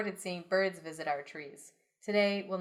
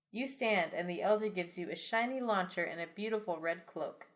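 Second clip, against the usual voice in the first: ordinary talk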